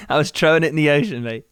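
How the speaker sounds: put-on quick, muddled Irish-British accent; [name]'s mock accent. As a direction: toss it off in a bad Irish brogue. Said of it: "I don't know what accent I'm doing".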